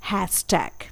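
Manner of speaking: हैशटैग said softly as "hash-tag"; pronunciation demo. Shown as a demonstration. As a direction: model हैशटैग gently. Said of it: "इसे बोला जाता है".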